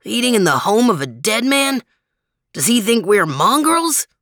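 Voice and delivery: scandalised, looking down on it; indignant snob. Acted. Max indignant.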